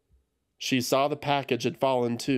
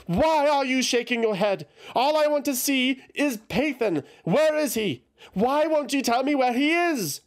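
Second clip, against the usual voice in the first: very high, loud panicked questions; frantic questioning; 12 semitones higher, 3 dB louder than usual. A narrator's reading. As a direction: demand answers in rising panic. Eothas growing frantic.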